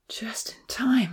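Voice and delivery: slow, breathy relieved whisper. A narrator's reading. Uncle Louis seeing the boats arrive, relieved hush. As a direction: sigh it out with relief. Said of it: "said with relief".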